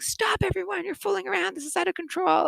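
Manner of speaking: high-pitched teacher calling order; scolding teacher. Acted.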